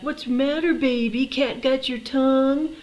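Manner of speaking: high, loud imitation of a mocking brother; teasing taunt. Big brother taunts his silent little sister, mocking. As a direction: needle her, drawing out the last words. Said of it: "make fun of my sister".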